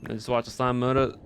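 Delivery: ordinary talk, nothing marked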